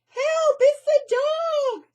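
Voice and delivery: very high, loud, slow frightened squeak. Acted. Melissa squeaking in fright at the dog. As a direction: squeak in terror. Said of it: "squeaked Melissa".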